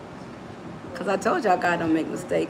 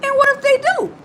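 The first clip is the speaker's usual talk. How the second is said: anxious thinker; 5 dB louder than usual. Retold or imitated. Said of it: "they think".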